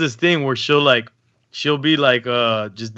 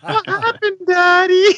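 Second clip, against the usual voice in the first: much higher, childlike imitation of a toddler, repeated; little daughter voice; slower than usual. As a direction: coo it again like a small girl. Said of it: "She goes".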